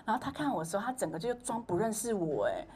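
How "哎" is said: ordinary talk, nothing marked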